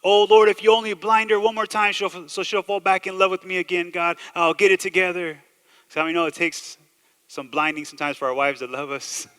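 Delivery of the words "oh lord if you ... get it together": loud, higher-pitched mock pleading; mock desperate prayer. Husband begs God to win back his wife, mock desperate. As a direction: cry out an exaggerated promise to God. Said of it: "we make all kinds of promises".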